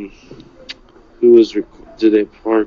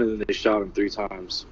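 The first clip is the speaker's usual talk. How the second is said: ordinary delivery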